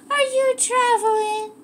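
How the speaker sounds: scene: owner teasingly asks her dog if it's traveling, in a very high voice; high dog-talk question. Real, not acted. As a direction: lift the whole question high like cooing at a puppy. Repeats question to dog, much higher.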